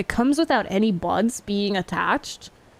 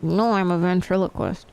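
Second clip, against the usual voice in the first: usual speaking voice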